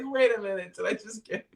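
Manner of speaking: high, laughing disbelief; cracking up as it dawns on him; amused realization. Retold or imitated. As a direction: catch yourself mid-laugh.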